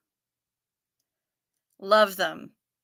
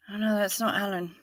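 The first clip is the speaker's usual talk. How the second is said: ordinary delivery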